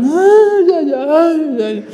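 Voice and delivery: high wailing cries; mock crying. Family sobbing at the seminary door, mock crying. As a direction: wail like the whole weeping family.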